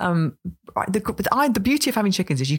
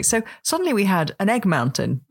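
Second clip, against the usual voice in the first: ordinary delivery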